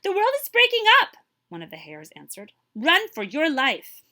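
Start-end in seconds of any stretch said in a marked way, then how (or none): 0.0-1.2 loud, very high alarm; much higher and louder panic; panicked shout. Acted. Hares warn the boar. 2.8-4.0 slow, loud, high urging; slow, louder panicked warning; urgent warning. Acted. Hares warn the boar.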